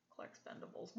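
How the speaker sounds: unvoiced, muttered under breath; muttered aside. Real, not acted. Mocking aside about the name.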